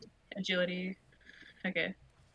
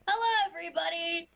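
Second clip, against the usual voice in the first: bright high clown greeting; happy clown; 8 semitones higher than usual. Acted. Sunny the clown greeting the kids, happy and high-pitched. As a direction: call out through a big goofy smile. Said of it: "Sunny happily walks out with just this big, goofy smile".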